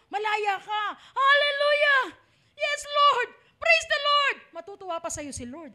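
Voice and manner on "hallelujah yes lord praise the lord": high, loud shout of praise; loud jubilant praise shout, slow on Hallelujah; joyful praise shout. Shown as a demonstration. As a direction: shout it out to the Lord.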